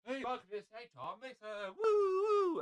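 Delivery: high-pitched stage welcome ending in a whoop; comedy show announcer. Acted. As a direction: introduce the act, then whoop high. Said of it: "when you're watching one of my comedy stuff".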